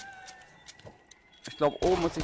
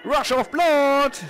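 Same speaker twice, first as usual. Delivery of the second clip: dramatic announcer; 6 semitones higher, 5 dB louder, much slower than usual. Acted. Intoning the game title.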